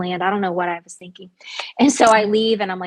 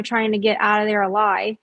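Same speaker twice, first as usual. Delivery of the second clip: ordinary delivery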